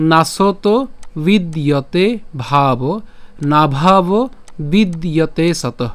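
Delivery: "নাসত", "বিদ্যতে ভাব" slowly, piece by piece; broken into parts. Shown as a demonstration. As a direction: separate "নাভাব" from "বিদ্যতে সতঃ" with clear pauses. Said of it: "শ্লোকটি আমি ভেঙে দিয়েছি".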